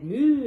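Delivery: 2 long, loud, high moo; 3 bellow it like a cow. Acted. 4 cow joining the animals' chorus, loud moo.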